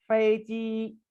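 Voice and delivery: 飞机 said with a level Mandarin first tone; teacher demo of 飞机; careful Mandarin model. Shown as a demonstration. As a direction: model 飞机 for learners. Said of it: "इसकी practice करेंगे".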